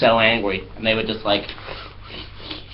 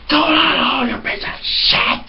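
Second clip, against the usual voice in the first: much higher, strained mock scream; crazy flatmate screaming; slower than usual. The flatmate screaming as Matthew leaves, mock scream. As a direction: scream it after him like a lunatic. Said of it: "And the guy was like".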